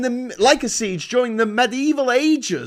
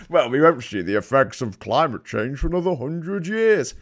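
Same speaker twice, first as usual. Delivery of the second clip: posh mock Rees-Mogg; 7 semitones lower than usual. Mocking Rees-Mogg quote.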